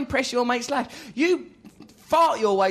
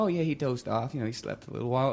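much softer, lower, gentle motherly tone; sweet maternal voice; 9 semitones lower, 8 dB quieter, faster than usual. Retold or imitated. Quoting the kind beautician, soft and motherly. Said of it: "Donna, maternal, sweet".

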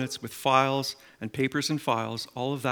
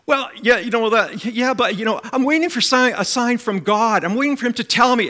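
higher, louder hesitant excuse; imitated bystander making excuses; hedging reply; 9 semitones higher, 7 dB louder than usual. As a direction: mimic a man dodging the question. Man answers evangelist, hedging.

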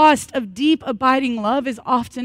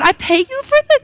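much higher, louder, incredulous squeal; mock indignation; 7 dB louder than usual. Joking to the counselor who asks about feelings, in mock indignation. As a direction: act comically outraged at the bill. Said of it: "And I said".

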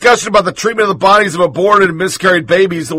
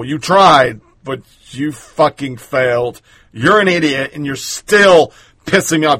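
usual speaking voice

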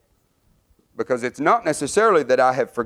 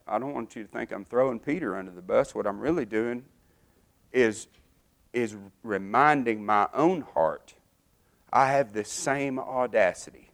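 much softer, drawn inward and confessional; quiet confession; 3 semitones lower, 7 dB quieter than usual. Real, not acted. Preacher admitting his own audacity to the congregation: quiet confession. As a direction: speak it low and softly, as to your own heart. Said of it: "I feel bad".

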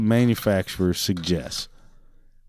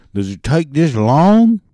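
mocking the customer, higher, louder and slow; mock whiny customer; 5 semitones higher, 7 dB louder, slower than usual. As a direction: drag it out like an impatient jerk. Mocking the impatient customer's question.

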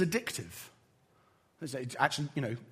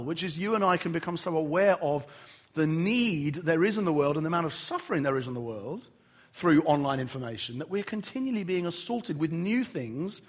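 ordinary talk

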